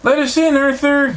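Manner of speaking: high, loud call for help; yelled. A narrator's reading. Binky yelling to Arthur to let them in, high and loud. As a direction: shout it up at the door, desperate. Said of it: "yelled Binky".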